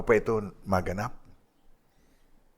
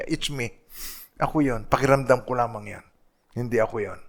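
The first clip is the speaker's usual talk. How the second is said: usual speaking voice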